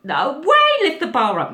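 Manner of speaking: high, loud frightened protest; panicked refusal. Retold or imitated. Scared on the chairlift refusing the boy, panicked protest.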